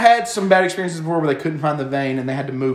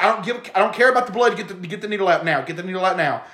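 ordinary delivery

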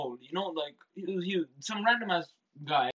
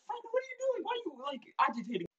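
very high, softer, panicked question; alarmed disbelief; 5 dB quieter, faster than usual. Retold or imitated. Scolding Franklin for inviting the girls onto the train, high panicked squeak. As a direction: squeak at your friend in horror.

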